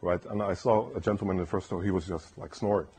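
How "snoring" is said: usual speaking voice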